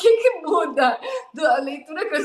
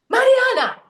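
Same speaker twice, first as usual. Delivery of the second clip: higher, louder mock yell of the ex's text; imitated yelling; 10 semitones higher, 4 dB louder than usual. Client reading her ex's message aloud, imitated high yell. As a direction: yell the name as if reading it furiously. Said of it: "e ela já gritava assim".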